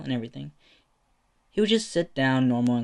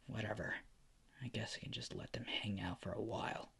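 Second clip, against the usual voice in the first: whispered thoughts; 9 dB quieter than usual. Acted. Izuku's sour inner thoughts.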